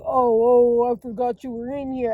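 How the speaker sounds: a hint of fake-surprised innocence, quick; lightly voiced boyfriend faking surprise; mock innocent man. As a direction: play the innocent guy caught out. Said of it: "acting like".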